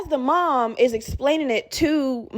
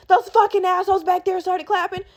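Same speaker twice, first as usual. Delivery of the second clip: high retold rant without full volume; mock screaming; 7 semitones higher than usual. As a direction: imitate an outraged mom, high-pitched. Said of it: "screaming with kids in the room".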